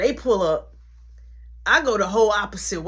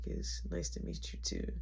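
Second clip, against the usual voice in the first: much lower, softer smooth-talker voice; deep mock-smooth voice; 15 dB quieter than usual. Meeting the pretty girl, deep mock-smooth intro. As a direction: drop way down to sound smooth.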